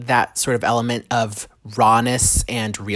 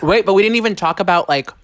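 mouth full; 7 semitones higher than usual. Real, not acted. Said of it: "sorry, I'm eating now".

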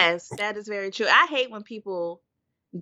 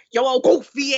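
mock Chicago slang; 4 semitones higher, 5 dB louder than usual. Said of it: "on four and them".

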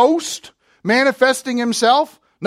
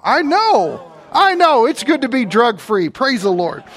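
usual speaking voice

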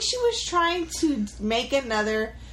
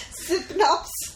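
slow, higher mock London accent; 7 semitones higher, slower than usual. Repeating the word after being teased, mock London accent. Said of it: "You sound like you're from London".

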